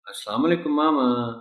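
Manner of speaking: put-on a child's greeting with "mama" stretched out; lengthened Mama. Shown as a demonstration. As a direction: draw out "mama" as a daughter addressing her mother. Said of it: "ماما کے لفظ کو کر رہے ہیں۔".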